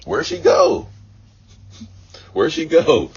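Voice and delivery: high, loud cry of disbelief; bewildered outcry. Retold or imitated. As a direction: call out for her as if she vanished. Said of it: "I'm like".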